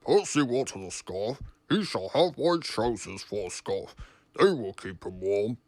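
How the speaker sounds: loud, low, stern verdict; stern boss voice. A narrator's reading. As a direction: hand down the punishment sternly. Sir Topham Hatt's punishment.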